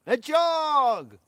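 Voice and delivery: long, high, booming shout of greeting; calling out. Real, not acted. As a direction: holler the name, drawn right out. Calls out a friend's name in greeting.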